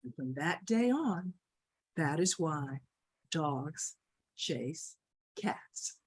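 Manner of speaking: low, hushed closing with pauses; solemn story ending. A narrator's reading. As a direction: drop low, word by word, to close.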